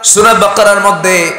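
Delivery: high, loud sustained proclamation; full-force preaching. Acted. Preacher proclaiming God's creation to the crowd, high and loud. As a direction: raise the voice and proclaim it.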